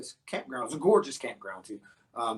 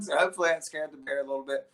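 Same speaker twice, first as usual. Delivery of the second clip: normal talking voice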